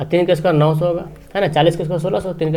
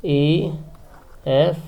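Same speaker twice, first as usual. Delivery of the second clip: slow, spaced-out letters; name each letter slowly, leaving space between; spelling out while writing; 4 dB quieter, much slower than usual. Real, not acted. Teacher labels the rectangle's corners, spelling out slowly.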